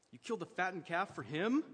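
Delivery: loud, high, outraged question; resentful son shouting his grievance; indignant outburst. Acted. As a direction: throw it back at the father in disbelief. Older son protests to father.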